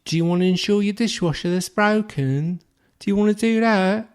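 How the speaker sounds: high, hammed-up condescending sales voice; mock high-pitched insurance seller; English saleswoman imitation. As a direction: play a patronising English phone saleswoman. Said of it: "she said".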